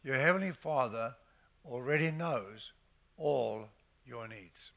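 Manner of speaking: ordinary talk, nothing marked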